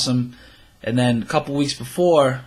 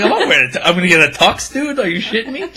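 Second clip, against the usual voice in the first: loud, higher retold outrage; incredulous protest; 8 semitones higher, 4 dB louder, faster than usual. Replaying his reply to Sean about the tux, incredulous. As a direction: act out indignant disbelief at a friend.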